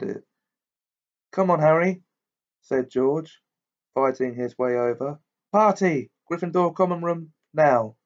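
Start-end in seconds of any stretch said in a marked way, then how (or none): 1.3-2.0 higher, louder cheerful call; excited call. A narrator's reading. George calling Harry to the party, excited. 5.5-8.0 high, loud gleeful shout; rally the team to party; excited call. A narrator's reading. George summoning the team to the common room, gleeful.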